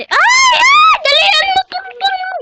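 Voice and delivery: very high, loud shriek for help; panicked scream. Real, not acted. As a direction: scream in sudden fright. Sudden danger, crying for help.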